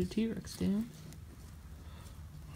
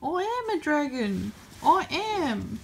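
mock-insistent T-Rex; 6 semitones higher, 4 dB louder than usual.

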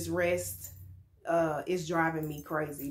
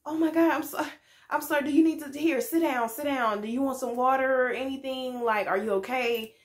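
higher, rushed fussing concern; flustered worried clerk; 7 semitones higher than usual. Retold or imitated. Shop clerk fussing over a crying customer, flustered and much higher. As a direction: play the panicked guy rushing to help. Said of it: "And he was just like".

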